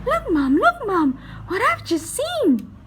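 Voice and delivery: put-on a little girl's high eager call; excited girl. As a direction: tug at Mom and call her to look. Girl calling her mother excitedly.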